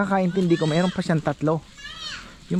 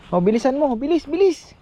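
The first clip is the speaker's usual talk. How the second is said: calling out; 12 semitones higher, 4 dB louder than usual. Real, not acted. Urging child to run.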